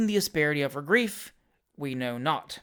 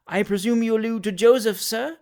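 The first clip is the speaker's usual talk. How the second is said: higher, strained attempt at composure; agitated, feigning composure; 5 semitones higher, 5 dB louder than usual. A narrator's reading. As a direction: try to sound composed while agitated. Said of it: "making an effort to appear composed".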